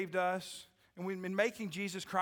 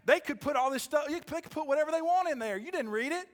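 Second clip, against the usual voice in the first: louder, higher preaching push; preaching build; 7 semitones higher, 4 dB louder than usual. Acted. Pastor pressing the church, in rising preaching force. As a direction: drive it home to the congregation.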